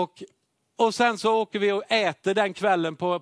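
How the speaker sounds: normal talking voice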